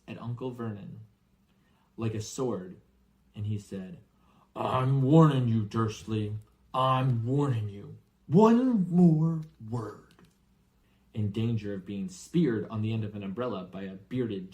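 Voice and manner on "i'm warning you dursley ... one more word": slow, loud menacing threat; threatening warning. Acted. Hagrid threatening Uncle Vernon with umbrella, loud slow menace. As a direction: warn him off, louder each time.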